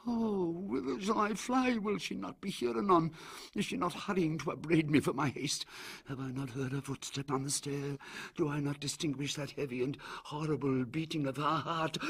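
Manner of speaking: high panicked pleading, rising in terror; frantic, fearful lament of a broken man; terrified cry. Acted. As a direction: plead in mounting horror, voice climbing. Usher's terrified outburst.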